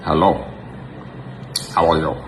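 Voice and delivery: lightly attempted Russian accent; short phrases in a mild Russian accent, spaced out; mock Russian accent. Shown as a demonstration. Man posing as Russian to get girls, mock Russian accent. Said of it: "Make sure you get Russian accent".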